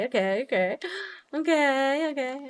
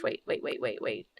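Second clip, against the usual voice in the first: whisper; 10 semitones lower, 6 dB quieter than usual. Real, not acted. Urgently stopping herself.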